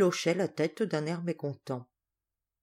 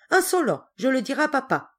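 high, loud outburst; indignant threat; 6 semitones higher, 4 dB louder, faster than usual. Acted. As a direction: explode like an affronted spoilt child. Boy threatens defiant servant.

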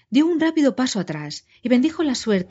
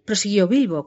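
ordinary delivery

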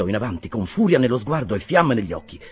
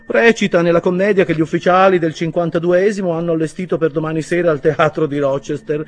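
higher, louder and lordly; haughty; 9 semitones higher, 11 dB louder than usual. A narrator's reading. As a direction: sneer it to the doctor. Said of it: "sempre più altezzoso".

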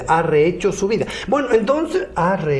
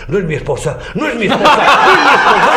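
rising louder, breaking into laughter; horrified husband insists it is not his wife; frantic repeated denial; 5 semitones higher, 7 dB louder than usual. Acted. Man at the mirror, building into laughing panic. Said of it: "decía".

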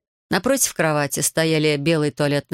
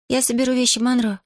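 put-on higher-pitched little girl's voice; muttering girl; 8 semitones higher than usual. A narrator's reading. Girl offers to pack Monro's things, muttering. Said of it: "пробормотала девочка".

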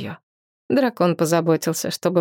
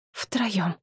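quiet; 3 semitones higher, 6 dB quieter than usual. A narrator's reading. Soft closing word.

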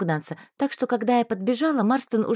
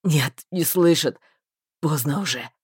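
lower, slow, resigned; hopeless male voice; 7 semitones lower than usual. A narrator's reading. Marston to the heroine, hopeless and low. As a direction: let the hope go.

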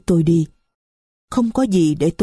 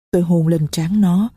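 usual speaking voice

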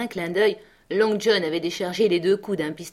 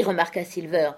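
ordinary delivery

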